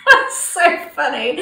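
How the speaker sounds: slow, loud words through laughter; real laughter running through the words; laughing. Real, not acted. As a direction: let the laugh carry it. Laughing while speaking.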